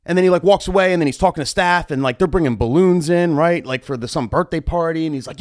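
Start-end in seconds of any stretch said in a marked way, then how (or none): none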